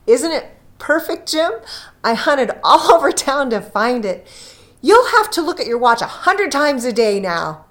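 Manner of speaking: loud, high and rushing; loud, eager delight; eager excitement. A narrator's reading. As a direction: she can't wait to show him. Della eagerly shows her gift.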